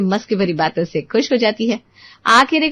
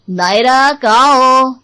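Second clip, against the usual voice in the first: P=A; calling out loud; 3 semitones higher, 6 dB louder, much slower than usual. Acted. Husband calling for wife.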